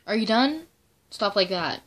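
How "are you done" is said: high, mocking imitation of the staff; sing-song mock concern. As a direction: lightly mimic a pestering staffer. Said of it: "be like".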